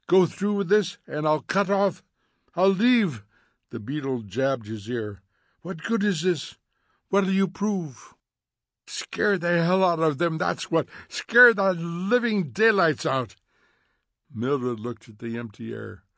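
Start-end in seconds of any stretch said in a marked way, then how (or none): 0.1-3.2 high, strained threat; urgent warning. A narrator's reading. Faber threatens to abandon Montag, urgent warning. 5.6-8.1 exasperated pleading. A narrator's reading. Faber argues in Montag's ear, exasperated. 8.9-13.4 high, loud, heated retort; angry defiance. A narrator's reading. Montag answers the voice in his ear, angry.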